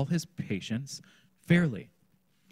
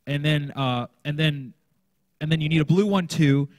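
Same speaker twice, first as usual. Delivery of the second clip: higher, louder, rushed overlong instructions; rambling teacher; 5 semitones higher, 8 dB louder than usual. Shown as a demonstration. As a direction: pile on the words like a scattered teacher. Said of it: "Instead of".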